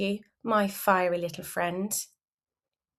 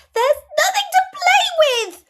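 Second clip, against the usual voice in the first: very high, loud whine; squeaky, stubborn childish complaint; stubborn child's shout; 8 dB louder than usual. A narrator's reading. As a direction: shout it like a pouting little kid. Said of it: "you stubbornly shout".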